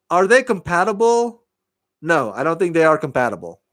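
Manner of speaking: ordinary talk, nothing marked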